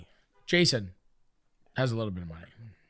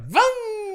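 engine vroom. Pretend. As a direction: rev it like a motorbike. Imitating a motorcycle engine.

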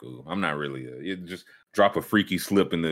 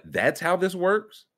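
incredulous; 9 semitones higher, slower than usual. Retold or imitated. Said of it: "I was like".

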